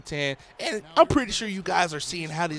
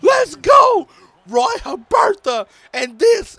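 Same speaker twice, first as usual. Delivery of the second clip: loud, high hype shouting; excited yelling; 10 dB louder than usual. Real, not acted. Gamer yells over his teammate's goal, real loud excitement. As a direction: yell it out like the crowd just erupted.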